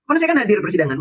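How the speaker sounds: normal talking voice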